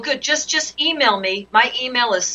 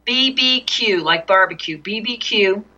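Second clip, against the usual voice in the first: slow, letter by letter spelling; dictating. Real, not acted. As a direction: spell it out slowly for writing down. Dictating email address letter by letter.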